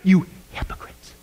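hissed rebuke in a whisper; harsh whisper. Acted. Jesus whispering a rebuke to the hypocrites. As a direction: whisper it like a stern warning.

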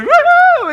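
very high, loud mock woo-hoo; exaggerated carefree cheer; gleeful whoop. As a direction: whoop it out in falsetto. Mimes yellow brick road glee.

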